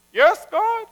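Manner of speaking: very high, obedient reply; attentive rabbi. Acted.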